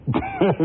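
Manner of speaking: carcajada corta y aguda; risa. Acted. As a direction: suelta una risa repentina en tono alto. Juez se ríe del acusado. (risita aguda de incredulidad)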